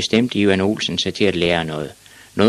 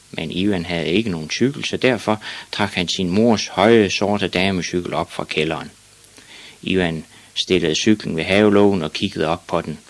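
plain reading voice

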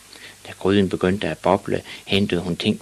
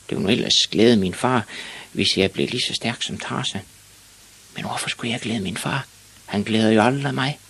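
put-on higher-pitched pondering boy; boy musing to himself; 5 semitones higher than usual. Ivan lies awake weighing possible wishes, musing. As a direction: think aloud in a higher boy's voice. Ivan's thoughts voiced in the story.

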